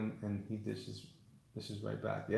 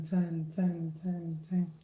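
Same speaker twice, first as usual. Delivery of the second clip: mock echoing repeat, higher; echo effect; 8 semitones higher, much slower than usual. Streamer jokingly echoing his own words like an arena. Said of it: "worst Hulk Hogan impersonator of all time".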